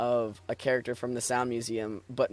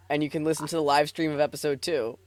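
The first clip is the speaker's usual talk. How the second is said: rapid defense; 3 semitones higher, 5 dB louder, faster than usual. Real, not acted.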